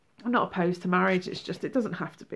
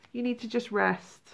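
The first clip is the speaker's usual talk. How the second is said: usual speaking voice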